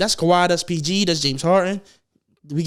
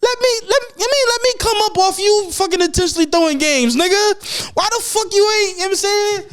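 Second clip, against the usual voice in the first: worked-up rant; 8 semitones higher than usual. Real, not acted. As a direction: build the outrage until it nearly squeaks. Escalating mock-outraged rant about betting.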